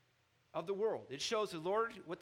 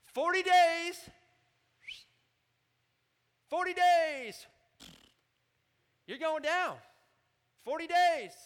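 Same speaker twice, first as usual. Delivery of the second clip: much higher and louder, hammering each forty days; blunt doom prophet; 12 semitones higher, 12 dB louder, slower than usual. Acted. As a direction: shout it like a prophet with no mercy. Jonah voiced threatening Nineveh.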